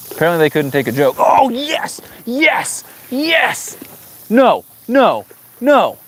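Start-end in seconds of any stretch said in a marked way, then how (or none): 1.2-6.0 cheering the bite, then losing it; excited then dismayed. Real, not acted. Big bite then loss.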